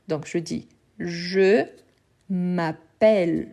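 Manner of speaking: m'appelle drawn out slowly, the open è of -pelle stretched; open vowel demo. Shown as a demonstration. Teacher demonstrating the open sound of m'appelle. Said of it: "Donc je vais ouvrir les sons".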